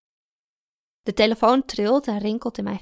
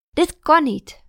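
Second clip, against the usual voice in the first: annoyed protest; 7 semitones higher, 8 dB louder, slower than usual. Acted. Woken by loud music at night.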